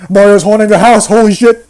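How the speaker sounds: loud, sudden alarmed outburst; mock panic. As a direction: blurt it out in fake alarm.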